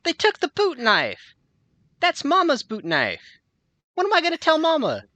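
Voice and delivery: high, loud, whining voice; whiny prisoner. Acted. Johnny whining to Ron about Mama's knife, high and loud. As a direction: fret like a kid about Mama.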